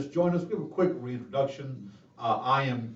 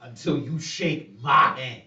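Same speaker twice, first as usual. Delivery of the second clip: slow, menacing Southern drawl, a touch louder; Calvin Candie impression; 3 semitones higher, 5 dB louder, slower than usual. Host quoting the villain's line from Django, slow Southern menace. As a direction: lean into a threatening plantation-owner accent. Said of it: "him as Calvin Candy is so good".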